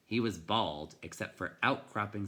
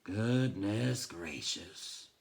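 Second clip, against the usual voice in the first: muttered; much slower than usual. A narrator's reading. Bookseller mutters at the dripping boy, slow and grudging. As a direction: mutter it to yourself, very slowly. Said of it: "and muttered".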